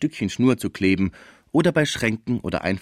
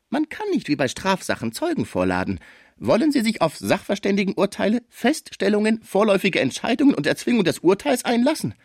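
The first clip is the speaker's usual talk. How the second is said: raised, insistent advocate's voice; streitbarer Villemot; 13 semitones higher than usual. Acted. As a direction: argue firmly for your client, pitched up. (energischer Anwalt, erhobene Stimme)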